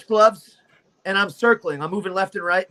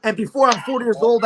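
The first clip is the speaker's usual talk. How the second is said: usual speaking voice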